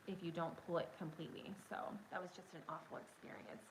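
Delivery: ordinary talk, nothing marked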